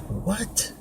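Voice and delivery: high, hushed disbelieving question; imitated mother. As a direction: squeak out a mother's startled what. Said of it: "My mother was like".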